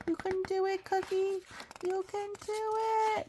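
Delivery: tiny high cheer-up voice; whisper-cheer yourself on, up high; high little inner cheerleader; high encouraging voice. Acted.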